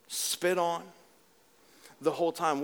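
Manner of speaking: usual speaking voice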